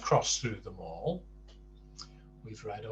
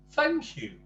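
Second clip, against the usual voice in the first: much higher, bright thanks; cheery Mister Happy; 5 dB louder than usual. Acted. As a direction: thank him with a big grin. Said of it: "said Mister Happy".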